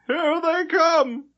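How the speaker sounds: high, slow, thrilled cry; excited chatter. Acted. Head clerk seeing the ship arrive, high and excited. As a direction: announce it breathless with excitement. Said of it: "chattered excitedly".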